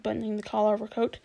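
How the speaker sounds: ordinary delivery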